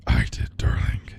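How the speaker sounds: harsh unvoiced whisper in her ear; violent whisper. A narrator's reading. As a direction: hiss it right into her ear. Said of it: "whispered violently into Blake's ear".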